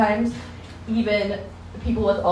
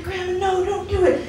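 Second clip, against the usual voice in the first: put-on high, pleading kid voice; pleading grandkid; 11 semitones higher than usual. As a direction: beg grandma to stop. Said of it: "they're gonna be like".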